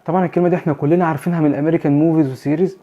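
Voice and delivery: ordinary talk, nothing marked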